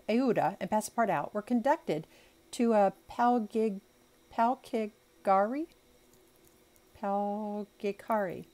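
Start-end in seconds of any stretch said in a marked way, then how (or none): 2.5-8.5 slow, halting attempts at "palkigari"; unsure pronunciation. Real, not acted. Reader stumbling over an unfamiliar carriage name, hesitant and slow. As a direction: sound out "palkigari" slowly, unsure of it. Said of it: "I don't know how to say it".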